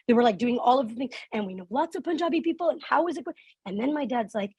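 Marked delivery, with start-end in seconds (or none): 1.3-3.4 high, eager imitation of parents; gushing parents. Parents telling the driver about Punjabi people they know, gushing. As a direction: chatter brightly at a new friend, higher pitched.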